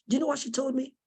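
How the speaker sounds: ordinary delivery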